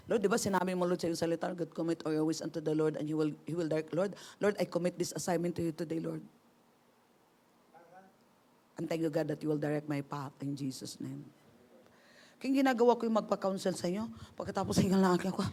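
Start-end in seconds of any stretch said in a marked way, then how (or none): 0.1-11.3 quiet prayer. Shown as a demonstration. Demonstrates quiet personal prayer.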